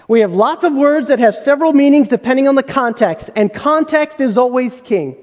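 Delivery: usual speaking voice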